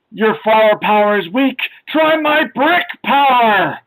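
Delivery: hammed-up shouting, stretched out at the end; mock shout. Comic characters chucking bricks at hippies, mock shouting. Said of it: "and shouting".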